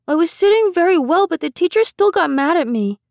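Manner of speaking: put-on high, loud child voice; whiny small boy pleading his case; aggrieved little boy. A narrator's reading. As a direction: protest like a wronged three-year-old. Small boy reports teacher trouble.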